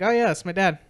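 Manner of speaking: suddenly bright and chirpy, imitating her; peppy.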